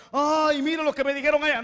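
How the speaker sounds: high, loud whiny complaint; mocking a self-pitying apostle, high and whiny; mock whining. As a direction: whine like someone about to cry. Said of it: "que se iba a poner a llorar".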